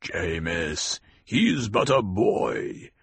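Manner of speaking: calm him down gently; low soothing rumble. A narrator's reading. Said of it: "heard the soothing in the rumbling voice".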